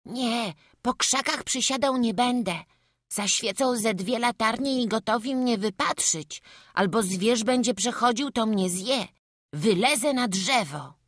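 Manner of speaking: put-on high, loud child thinking aloud; high-pitched, decisive kid; scheming little boy. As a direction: reason it out as a determined small boy. Voices the boy planning his hiding.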